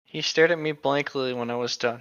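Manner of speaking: ordinary talk, nothing marked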